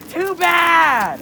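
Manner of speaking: loud, high teasing shout. Real, not acted. Refusing his complaint, playful.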